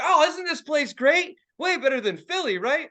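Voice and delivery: hammed-up sightseer enthusiasm; gushing tourists. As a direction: imitate delighted tourists fishing for agreement. Acting out gushing tourists seeking agreement.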